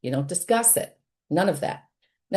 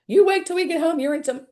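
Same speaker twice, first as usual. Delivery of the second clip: high, sharp mock scolding of a child; scolding threat; 10 semitones higher than usual. Parent mocking the scolding she forbids, sharply threatening. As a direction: threaten the kid like a fed-up parent. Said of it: "No scolding".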